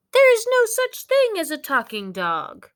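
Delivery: high, loud scornful insistence; scoffing doubter. Acted. A doubter loudly scoffing at the talking dog. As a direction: sneer it out like a skeptic.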